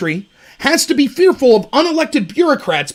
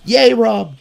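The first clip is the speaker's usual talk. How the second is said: mock self-cheer; 2 semitones lower than usual. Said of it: "I'm proud of myself".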